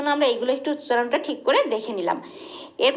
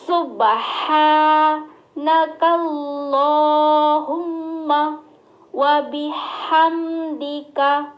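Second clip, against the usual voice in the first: chanted very slowly and louder; slow recitation; 2 semitones higher, 4 dB louder, much slower than usual. Shown as a demonstration. Teacher reciting the Sana slowly for students. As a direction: intone the Arabic in long held notes. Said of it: "ধীরে ধীরে পাঠ করব".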